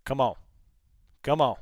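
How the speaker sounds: normal talking voice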